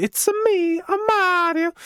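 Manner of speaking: very high, hammed-up Mario voice; Mario impression. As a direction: do the Italian plumber, way up high. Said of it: "I think".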